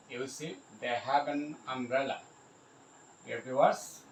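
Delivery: ordinary talk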